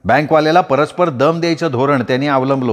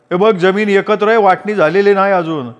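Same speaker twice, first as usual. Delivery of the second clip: higher-pitched brother voice, pressing his point; brother insisting; 7 semitones higher than usual. A narrator's reading. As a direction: argue it, lightly acted. Brother argues land is shared. (भावाचा हट्टी आवाज)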